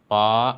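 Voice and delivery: पा level and steady; पा meaning eight, flat tone; flat first tone. Shown as a demonstration. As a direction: hold पा on one even pitch. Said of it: "first tone जो flat tone है".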